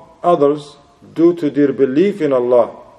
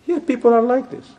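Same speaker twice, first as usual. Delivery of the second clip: rueful high remark; 10 semitones higher than usual. Acted.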